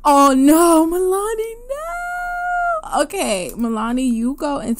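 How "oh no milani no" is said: high shocked wail of dismay; shocked cry. Real, not acted. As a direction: cry out in horror at the news. Milani turns out pregnant.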